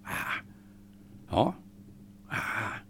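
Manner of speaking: normal talking voice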